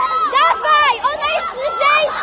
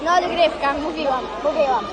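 much lower conversational voice; low-key aside; 12 semitones lower, 7 dB quieter, faster than usual. Real, not acted. Telling companions there may be overtime, low-key. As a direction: drop from yelling to low, settled talk.

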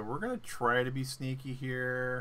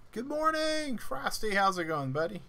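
very high sing-song greeting to a pet; 10 semitones higher than usual. Acted. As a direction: coo good morning at the pet. Greeting pet creature in game.

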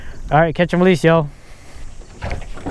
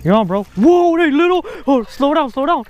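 excited; 9 semitones higher than usual. Real, not acted. Friend hooks a fish.

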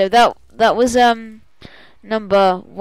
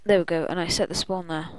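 ordinary talk, nothing marked

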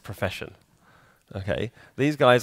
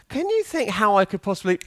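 mock plea; 9 semitones higher than usual. Acted plea to a colleague.

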